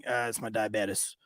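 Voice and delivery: low, soft imitated excuse; druggie. Man explaining bulge in sock, low feeble mumble. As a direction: offer the excuse under your breath. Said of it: "He's like".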